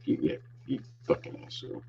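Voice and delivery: whispered, fast muttered curse; under-breath insult. Retold or imitated. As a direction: mutter it so he can't hear.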